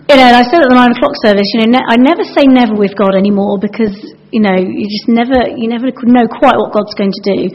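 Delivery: normal talking voice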